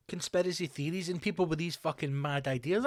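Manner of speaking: ordinary talk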